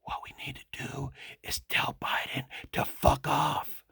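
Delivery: slow, breathy mock whisper as Biden. Mocking the president in a slow whisper. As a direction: hush it like a sleepy Biden, slowly. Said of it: "let me whisper like Biden".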